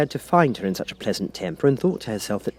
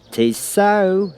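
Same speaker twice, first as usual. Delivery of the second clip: high, loud pronouncement; Duchess, louder and higher; 10 semitones higher, 5 dB louder than usual. A narrator's reading. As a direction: declare it grandly. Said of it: "said the Duchess".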